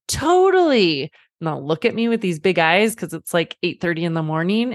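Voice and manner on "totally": a high, slow, drawn-out "Totally"; mock enthusiastic yes. Dad to his girls asking for cookies, mock-eager yes. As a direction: sell the yes with exaggerated cheer. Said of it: "And I'm like".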